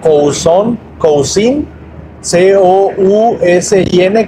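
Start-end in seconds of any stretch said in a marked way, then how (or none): 2.2-4.3 each letter of "C O U S I N" named slowly and separately; spelled out letter by letter. Shown as a demonstration. Teacher spelling a German word for Spanish learners, letter by letter. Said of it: "y se escribe cousine".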